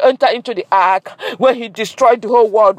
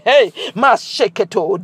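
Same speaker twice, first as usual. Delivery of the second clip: ordinary delivery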